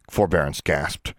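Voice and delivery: plain reading voice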